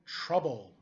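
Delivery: ordinary delivery, nothing marked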